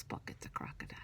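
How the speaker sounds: soft secret aside; whispered aside. Acted. Teacher telling the class a secret about the book. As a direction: lean in and whisper it.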